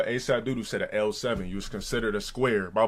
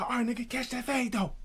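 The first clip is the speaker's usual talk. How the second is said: very high, softened imitation of a rival; mock challenge; 12 semitones higher, 5 dB quieter than usual. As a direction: mimic a rival calling you out, high-pitched. Rival's fight challenge, retold.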